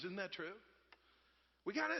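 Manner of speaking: ordinary talk, nothing marked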